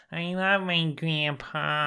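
slow, garbled baby-talk impression; mock little-boy voice. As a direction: drag it out like a tongue-tied child. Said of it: "then he gets all like".

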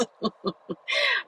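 soft, high giggle; laugh. Real, not acted. Dog owner amused about the new harness, giggling softly. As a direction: giggle quietly to yourself.

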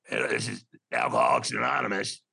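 low smoker's voice on the phone; gruff smoker Madge. Retold or imitated. Woman answering the AA helpline, low smoker's voice. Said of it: "that's how she talked".

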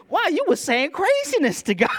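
high, loud, laughing mock amazement; laughing disbelief. Pastor rereading old prayer journal, laughing in disbelief.

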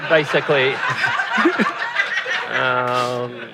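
chuckling through the words; laughing. Real, not acted. Laughing about failed application.

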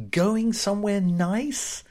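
slow, high mocking echo of the phrase; mocking repeat. Shown as a demonstration. Mocking the hairdresser's stock question, slow and high. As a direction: drag out "Going" scornfully. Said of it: "What a redundant phrase".

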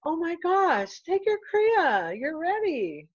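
high, drawn-out exclamation; excited urging. Retold or imitated. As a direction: urge a friend on, imitated with big swooping pitch. Retold friend urging her to go ahead.

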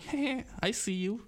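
a quiet knowing greeting to a lookalike; knowing nod. Retold or imitated. Greeting a stranger who looks like you, knowing and soft. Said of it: "bist du immer so ein bisschen so".

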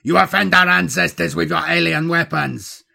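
high, loud warlord's tirade; shouted accusation. A narrator's reading. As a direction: hurl the accusation at full voice. Warlord keeps shouting in battle.

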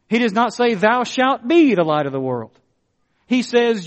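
fervent sermon voice; preaching. Acted. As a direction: press the point home. Preacher repeats at full force.

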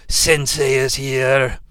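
solemn mock samurai reporting to his master; Drift. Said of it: "when Drift finds Optimus Prime, he says".

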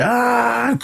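high, drawn-out mock strike cry; long high "ah" as the blow lands; imitated karate yell.

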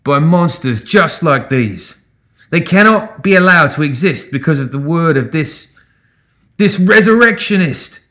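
high, rising rabble-rouser's tirade; deliberate, scornful public condemnation; indignant denunciation. A narrator's reading. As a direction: build to a disgusted accusation. Agitator denouncing undead to crowd.

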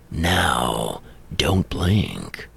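deep, low trailer announcer voice; movie trailer voice. Shown as a demonstration. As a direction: drop low for the trailer tagline. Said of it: "It's not John Bailey, the Honest Trailer voice".

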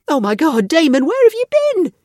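high, loud burst of relief; relieved cry. A narrator's reading. Relieved grandmother finds boy.

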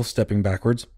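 ordinary delivery, nothing marked

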